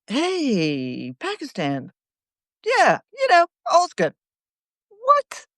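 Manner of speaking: mocking. Mocking fake reassurance to Pakistan.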